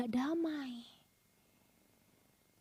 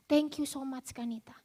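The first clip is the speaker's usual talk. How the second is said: barely voiced, softer whisper; whispered thanks; 4 semitones lower, 9 dB quieter than usual. Woman thanking Kanita in person, in a whisper. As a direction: breathe the thanks, almost silent.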